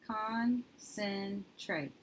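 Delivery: Concentrate stretched very slowly, syllable by syllable; slow sounding out. Shown as a demonstration. Teacher modelling sounds of a new word, very slowly. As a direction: drag out each syllable of Concentrate. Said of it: "So let's do our sounds".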